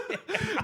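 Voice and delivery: sudden high laugh; high burst of laughter. Real, not acted. Host bursts out laughing high at the story.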